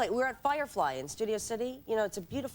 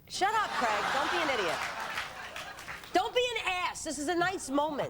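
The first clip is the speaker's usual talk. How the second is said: high, playful telling-off; mock indignant; 7 semitones higher than usual. Real, not acted. Guest scolding host for spoiling her proposal story, indignant. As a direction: scold the heckler, voice raised in pitch.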